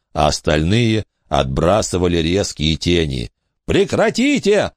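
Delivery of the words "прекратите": high desperate yell; shouting. A narrator's reading. Billy screaming at the ghost to stop. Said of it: "снова закричал Билли".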